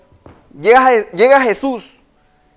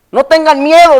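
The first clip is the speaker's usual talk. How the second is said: Jesus calling out over the storm, louder and higher; 5 semitones higher, 6 dB louder than usual. Acted. Preacher voicing Jesus shouting to scared disciples, louder. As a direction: call it across the water, a touch raised. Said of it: "Imagínatelo".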